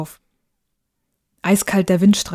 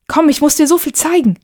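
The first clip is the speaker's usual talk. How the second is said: put-on high, louder, excited boy; eager urging; 8 semitones higher, 5 dB louder than usual. Boy pulls mother to the window, excited. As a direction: urge her along eagerly.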